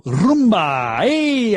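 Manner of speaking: "Rumba" with a long rolled R, stretched out, then a high "Hey"; drawn-out "Rumba" with trilled R and whooped "Hey". Shown as a demonstration. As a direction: trill the R of "Rumba" like a festive station shout. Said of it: "I can just roll my tongue".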